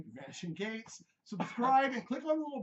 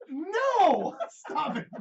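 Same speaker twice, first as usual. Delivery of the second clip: laughing loud protest; shouting; 10 semitones higher, 11 dB louder, much slower than usual. Real, not acted. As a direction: yell it out. Host yelling at joke.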